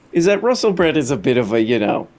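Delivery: ordinary talk, nothing marked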